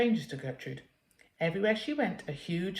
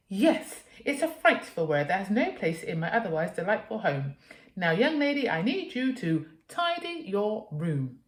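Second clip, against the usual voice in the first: prim scolding mother; 3 semitones higher, 3 dB louder than usual. Acted. Mother ordering daughter to tidy, prim scolding. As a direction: lay down the law primly.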